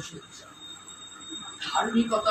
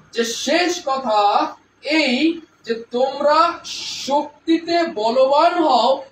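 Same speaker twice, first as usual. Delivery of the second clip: each word drawn out and louder; slow, stressed reading; 3 semitones higher, 4 dB louder, much slower than usual. Acted. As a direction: weigh every word slowly. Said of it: "শব্দগুলোর উপর আমরা জোর দিই না". (ধীরে, প্রতিটি শব্দে জোর দিয়ে)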